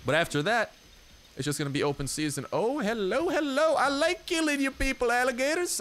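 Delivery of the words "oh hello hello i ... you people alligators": high, sing-out greeting to the prey; playful taunting call. Pretend. Player greeting alligators he likes killing, playful taunt. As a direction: call out high with mock-friendly glee. Spots alligators, calls out to them.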